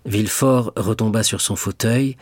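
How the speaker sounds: ordinary delivery, nothing marked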